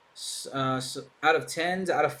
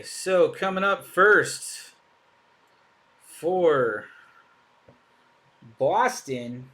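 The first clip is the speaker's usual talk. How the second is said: hammed-up Boston accent, louder and drawn out; bad Boston accent; 3 semitones higher, 7 dB louder, slower than usual. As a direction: announce it like a mock Boston local. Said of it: "That was a terrible accent".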